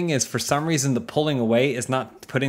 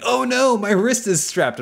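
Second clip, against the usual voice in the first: high, loud, slow mock panic; 11 semitones higher, 4 dB louder than usual. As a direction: whine it like a helpless movie hero. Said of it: "his whole thing is he's like".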